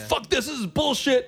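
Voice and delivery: high-pitched mock outburst of swearing; cursing outburst. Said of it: "He's cursing now".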